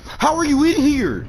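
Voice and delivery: high, loud disbelief; shocked cry. Real, not acted. Player spotting the snake underwater, shocked high cry. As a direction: shout it in shock.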